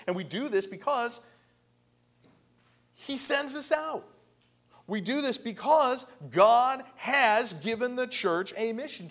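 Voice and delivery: high-pitched, driving pulpit delivery; impassioned preaching. Real, not acted. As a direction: press the point home to the congregation. Preaches at full force.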